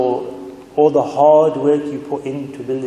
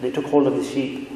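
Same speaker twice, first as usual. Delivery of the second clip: ordinary talk, nothing marked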